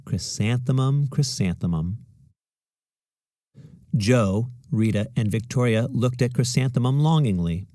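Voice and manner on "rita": ordinary delivery, nothing marked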